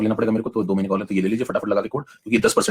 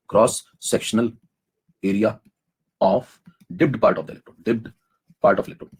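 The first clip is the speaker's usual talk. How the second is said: slow dictation; much slower than usual. Shown as a demonstration. Teacher dictating the definition slowly to the class. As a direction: spell it out slowly, word by word.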